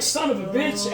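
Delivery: high-pitched mock curse; retold exasperation. Driver learning blunt was left behind, mock exasperation. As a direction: curse in high, playful dismay.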